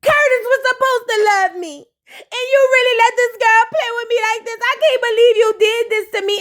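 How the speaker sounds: mock wounded whine. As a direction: whine like a betrayed friend. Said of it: "Sierra was like".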